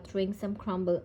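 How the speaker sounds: ordinary delivery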